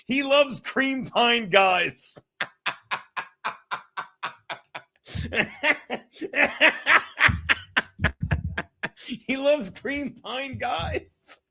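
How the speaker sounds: drawn out slowly for effect; milk the punchline slowly; slow, savoured punchline. Shown as a demonstration. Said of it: "I gotta read this".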